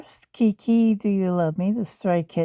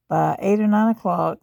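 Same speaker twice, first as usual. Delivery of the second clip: normal talking voice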